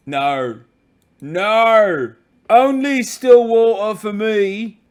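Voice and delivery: hammed-up British bellow, rising high and loud; over-the-top British lad outburst; mock British shouting. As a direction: build to a loud, drawn-out British refusal. Mock British refusal building to shout.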